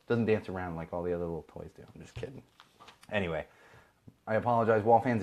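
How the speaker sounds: ordinary talk, nothing marked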